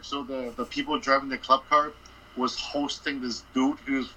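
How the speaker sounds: ordinary talk, nothing marked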